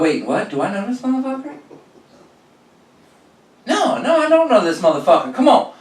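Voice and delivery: high, quick disbelieving mutter; incredulous. Retold or imitated. Robber voiced baffled at the cashier's hello. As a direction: puzzle over who this guy is. Retold confused robber thought.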